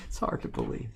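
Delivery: breathy whispered repeat. Shown as a demonstration. Repeating a viewer's line back as a whisper. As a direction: hush it right down to a whisper. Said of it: "Like that".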